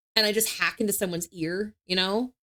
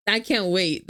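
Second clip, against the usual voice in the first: ordinary talk, nothing marked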